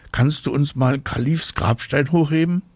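put-on high, wheedling child question; child's request. A narrator's reading. Girl asks father a favour.

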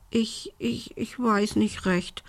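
soft, high, halting stammer; timid stammer. A narrator's reading. Little witch doubts her courage, stammering. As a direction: falter on the first word, small and hesitant. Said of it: "stotterte Belinda".

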